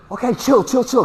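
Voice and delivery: high, hurried mock soothing; mock calming. Master calming the frantic student on the phone, mock soothing. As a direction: talk the panicking guy down, still keyed up. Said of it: "I was like".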